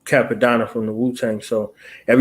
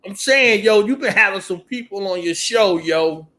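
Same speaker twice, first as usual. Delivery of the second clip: higher eager fan voice; imitating fans; 6 semitones higher than usual. Host mimics fans greeting him on the street, eager. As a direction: do the fan who won't let up. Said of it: "They be like".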